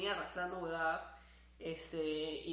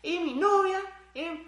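excited; 7 semitones higher, 10 dB louder than usual. Real, not acted. Declaring ideal love, laughing.

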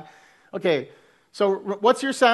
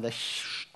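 whispered shh hiss; shushing sound; 12 dB quieter than usual. Shown as a demonstration. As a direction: breathe out a soft shh. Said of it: "make the hissing, the sibilant sound".

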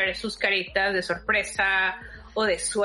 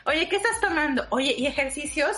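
higher-pitched, quick run of questions; retold eager questioning; 7 semitones higher, faster than usual. Teacher quizzing students on stretching, retold eager questioning. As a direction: mimic asking around, pitched up. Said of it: "yo siempre le preguntaba".

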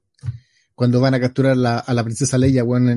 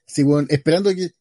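normal talking voice

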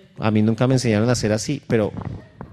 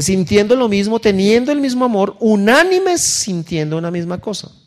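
scripture read aloud, raised and slow; lectura bíblica; 7 semitones higher, 4 dB louder, slower than usual. Acted. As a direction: read the verse like a preacher. Reading scripture in sermon. (lectura solemne del versículo)

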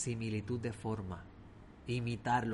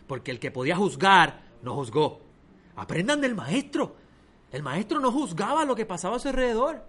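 loud, high fervent sermon; forceful preaching; 6 semitones higher, 6 dB louder than usual. Acted. Preacher exhorts the congregation to learn from the master, loudly. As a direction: drive the lesson home with fervour.

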